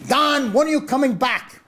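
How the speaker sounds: high, pushy imitated promoter voice; loud impression of a pushy promoter; mock Don King. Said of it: "he's screaming at everyone there".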